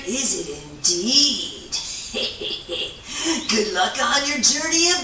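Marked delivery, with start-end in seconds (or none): 1.8-3.4 quiet, soft little chuckle; a hushed amused laugh; soft airless laugh. Acted. The otter amused by Beaver's riddle, soft laugh.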